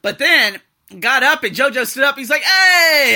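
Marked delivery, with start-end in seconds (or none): 2.4-3.1 big, high holler of hello; shouted greeting. Retold or imitated. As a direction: call out like greeting an old buddy. Acts JoJo's loud greeting.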